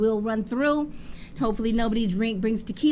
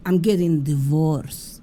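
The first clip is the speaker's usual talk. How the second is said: lower, slow, louder store-owner voice; mock sad confession; 7 semitones lower, 4 dB louder, slower than usual. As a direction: drop it low and heavy like bad news.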